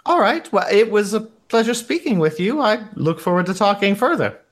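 put-on high, courteous German-accented receptionist; Ramona. Receptionist ends a pleasant chat with a guest, German accent. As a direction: sign off politely, pitched high, in a German accent. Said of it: "some variation of my shitty German accent".

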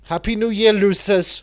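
loud, high holler at the losers; mock jeering shout. Host hollers a New Year taunt at losers, mock jeering shout.

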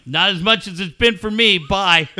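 mock-brutal send-off to a sacked employee; harsh dismissal. Gamer acting out firing the coach, harsh and dismissive.

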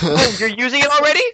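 high, hammed-up fake surprise; high mock promo voice; mock YouTuber pitch. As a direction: play an over-keen advertiser caught off guard. Parody of annoying channel ad.

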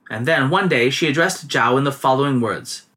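ordinary delivery

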